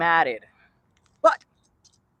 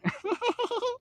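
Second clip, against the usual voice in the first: high, breathless, unhinged giggle; a real laugh that comes out weird and psychotic; 6 dB quieter than usual. Real, not acted. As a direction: let a strange crazed laugh slip out. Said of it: "That was a really weird, psychotic laugh".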